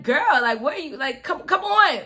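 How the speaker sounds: loud, high mock disbelief at the doctor; incredulous. To the doctor, loud disbelieving plea.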